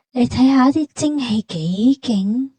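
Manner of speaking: normal talking voice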